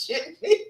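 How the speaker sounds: a sudden high laugh breaking into the line; burst of laughter. Real, not acted. Host reading chat laughs out high mid-line.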